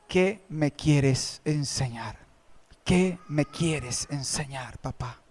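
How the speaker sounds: soft, slow, low tearful plea; whispered-down weeping prayer to his father; tearful secret prayer. Retold or imitated. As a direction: pray through tears, alone and quiet. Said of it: "Llorarle a mi padre en secreto".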